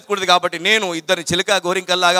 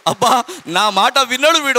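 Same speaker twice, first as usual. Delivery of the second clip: moved teacher; 8 semitones higher, 3 dB louder than usual. Retold or imitated. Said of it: "ఏడుపు వచ్చేది మేడంకు".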